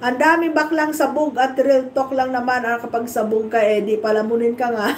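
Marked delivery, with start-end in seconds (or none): none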